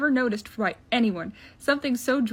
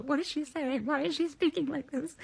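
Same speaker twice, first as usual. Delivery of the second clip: soft, higher-pitched hushed aside; light stage whisper, still voiced; mock whisper; 4 semitones higher, 6 dB quieter than usual. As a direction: ask it quietly, pitch raised. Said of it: "Bucky whispers to T'Challa".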